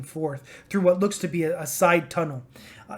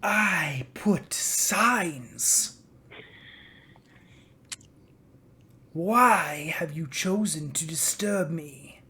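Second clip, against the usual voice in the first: weary dragon; 5 dB quieter, much slower than usual. Acted. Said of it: "The dragon sighs deeply and says".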